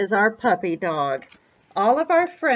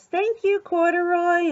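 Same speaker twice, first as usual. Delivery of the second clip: high-pitched little bird voice; small storybook bird, pitched well up; 7 semitones higher than usual. Acted. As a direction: chirp it sweetly as a grateful bird. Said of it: "The bird says".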